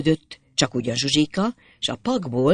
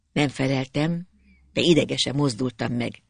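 ordinary delivery

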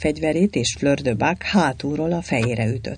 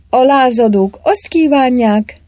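put-on higher, louder young woman's voice; raised, higher woman's pitch; Anna's voice; 9 semitones higher, 8 dB louder than usual. A narrator's reading. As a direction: speak up boldly as the young lady. Anna addresses the count.

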